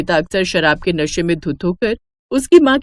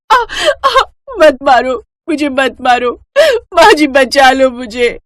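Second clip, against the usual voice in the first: high, slow cries of a beaten wife; begging husband to stop beating her, high and pained; pleading in pain; 6 semitones higher, 4 dB louder than usual. Acted. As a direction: beg him to stop, voice straining. Wife being beaten by drunk husband.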